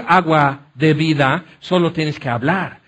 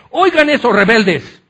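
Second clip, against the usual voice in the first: angry Moses; 5 semitones higher, 4 dB louder than usual. Retold or imitated. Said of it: "ya están enojados".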